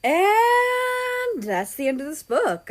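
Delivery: high, slow singsong sign-off; high drawn-out playful outro; sing-song outro. Real, not acted. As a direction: stretch out the goodbye playfully. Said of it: "reader's playful outro".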